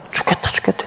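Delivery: breathy whisper of the grumbling Israelites; whispered complaint. Retold or imitated. Preacher whispering the people's complaint, hushed.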